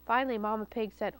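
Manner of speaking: ordinary delivery, nothing marked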